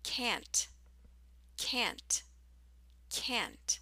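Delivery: "can't" with a long cat vowel, falling. Shown as a demonstration. Teacher drilling "can't" for learners, long cat vowel. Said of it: "more like the vowel you hear in the word cat".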